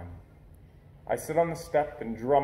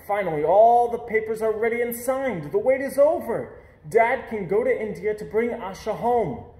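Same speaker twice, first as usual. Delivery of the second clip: higher, louder, bursting with joy; excited; 6 semitones higher, 7 dB louder than usual. A narrator's reading. Boy telling how the long wait finally ended, excited. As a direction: read it like the best news ever. Adoption papers finally come.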